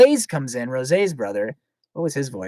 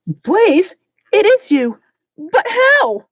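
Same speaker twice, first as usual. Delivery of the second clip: high, loud amazed voice; high amazed Rosé; 9 dB louder, much slower than usual. Acted. Rosé amazed her brother is back, high and loud. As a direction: cry it out high in wonder.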